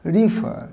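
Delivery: রিফার with "i" first and silent final r; corrected রিফার. Shown as a demonstration. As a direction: repeat রিফার as "ri-fur". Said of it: "এর উচ্চারণটা হবে".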